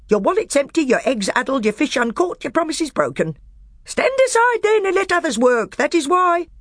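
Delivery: high, loud, heaping insults; scornful dwarf. A narrator's reading. Nickabrick mocking the magician in open anger. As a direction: jab each name at him.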